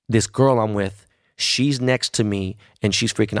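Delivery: ordinary delivery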